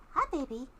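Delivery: high soft coo to a pet. Real, not acted. Greeting her raccoon in a high coo.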